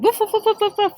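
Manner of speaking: high, rapid-fire woofs; barking dog. A narrator's reading. Rufus barking at the wolf, dog barks. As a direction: bark like an agitated dog. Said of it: "Rufus was barking".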